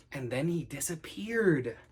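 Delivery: ordinary delivery, nothing marked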